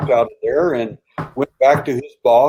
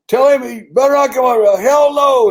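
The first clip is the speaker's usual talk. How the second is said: much higher and louder, retold furious scream; 14 semitones higher, 9 dB louder than usual. Old pilot refusing a visitor over the phone, furious scream. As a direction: hurl it like a man yelling down the phone line. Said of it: "I heard the guy screaming over the phone".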